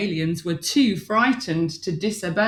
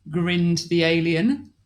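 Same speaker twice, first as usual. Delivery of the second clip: plain reading voice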